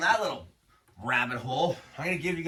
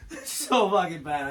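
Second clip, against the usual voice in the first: ordinary talk, nothing marked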